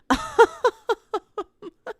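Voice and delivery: high, staccato giggle; high-pitched cackle at own silliness; laughing. Real, not acted. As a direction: burst into a high string of giggles. Laughs after clowning about books.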